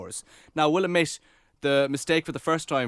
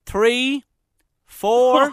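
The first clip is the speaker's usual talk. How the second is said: imitating nervous count; 8 semitones higher, 6 dB louder than usual. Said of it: "Nathan goes".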